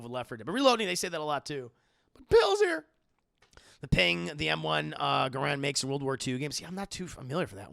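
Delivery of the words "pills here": high, loud survivor callout; Lewis. Retold or imitated. Streamer quoting a game character to chat, high loud callout. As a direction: call it across to your squad. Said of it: "I always think of Lewis saying".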